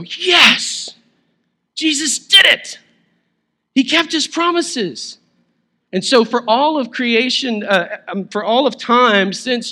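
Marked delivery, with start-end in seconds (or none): 0.0-5.2 high, loud, slow joyful cry; exultant churchgoer; joyful exclaiming. Pretend. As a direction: shout it out with Easter joy. Voicing the congregation's joyful feeling.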